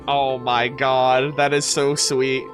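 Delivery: low, slow, tender murmur; touched. Real, not acted. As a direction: melt at how sweet it is, low and drawn out. Moved reaction to sad story.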